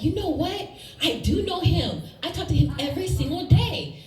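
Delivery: mock eager answer with a laugh; excited reply. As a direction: proudly claim Jesus as a friend. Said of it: "And I was like".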